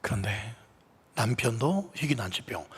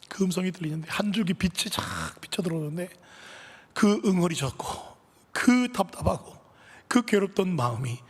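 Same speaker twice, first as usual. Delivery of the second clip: ordinary delivery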